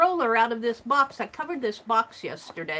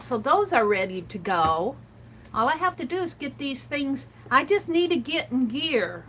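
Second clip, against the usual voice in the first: ordinary delivery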